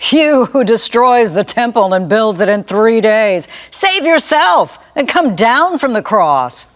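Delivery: loud, high jeering taunt; railing mocker. A narrator's reading. Passerby jeering at the crucified man, loud and high. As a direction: sneer it up at the cross, loud and high. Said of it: "railed at him".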